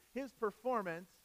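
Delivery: ordinary talk, nothing marked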